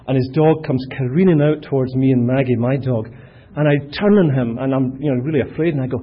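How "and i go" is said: ordinary talk, nothing marked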